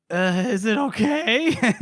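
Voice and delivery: high, slow, drawn-out question; hammed-up anxious wounded voice; worried patient. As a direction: whine it like a frightened patient. Wounded woman asks about wound.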